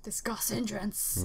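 whispering. Real, not acted. Reads game option aside.

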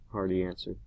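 plain reading voice

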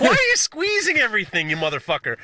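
high-pitched, exasperated whine; scolding a chef for squeezing the burger, mock indignant and high; mock outrage. Mock rant at Salt Bae.

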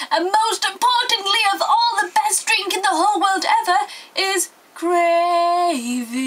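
high, grandly building announcement ending on a long drawn-out word; hyped-up reveal. Acted. Vlogger revealing Yorkshire's best drink ever, hyped-up reveal. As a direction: announce it like the greatest thing ever, stretching the last word.

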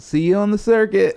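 light mimic of the driver's farewell; Hollywood lady. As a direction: toss it off like a fellow comic. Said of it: "the last thing she said".

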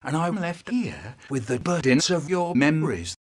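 ordinary delivery

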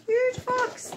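high, loud thrilled squeal; excited squeal. Real, not acted. Finding a surprise box on the porch, high thrilled squeal. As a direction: gasp it out with delight.

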